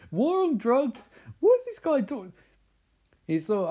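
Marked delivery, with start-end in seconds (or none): 0.1-2.3 much higher, slow incredulous mock voice; mocking squeal. Friends mocking a conspiracy rant, high incredulous squeal. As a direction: squeak it out in disbelief.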